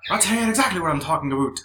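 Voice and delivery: fast South Park-style put-on accent. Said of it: "they South Park you".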